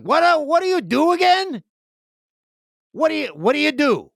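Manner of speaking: mock confused friend at dinner; clueless dinner companion. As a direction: ask like you've never met him. Dinner companions asking, retold.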